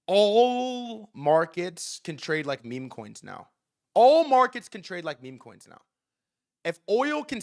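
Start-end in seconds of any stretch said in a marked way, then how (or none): 0.1-3.5 each word laid down slowly and deliberately; slow emphatic. Real, not acted. To listeners, stating his big takeaway slowly and deliberately. Repeating the key point for emphasis. 3.9-5.8 louder punchline. Real, not acted. To listeners, restating his takeaway louder and punchier. Repeating the key point for emphasis.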